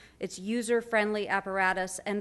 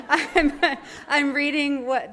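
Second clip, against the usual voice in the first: higher and louder through a laugh; amused, laughing; 5 semitones higher, 7 dB louder, slower than usual. Real, not acted. Speaker explains the phone to the audience, laughing. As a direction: talk through your own laughter. Laughs after joking about texting.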